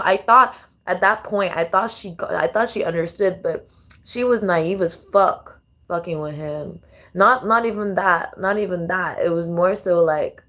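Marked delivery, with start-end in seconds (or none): none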